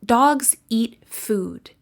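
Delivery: Dogs, eat, food each stressed slowly, even beats; stress rhythm demo. Shown as a demonstration. Teacher demonstrates Dogs eat food stress rhythm. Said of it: "The words in yellow are stressed".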